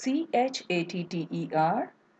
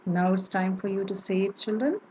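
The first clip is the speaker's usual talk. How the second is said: ordinary talk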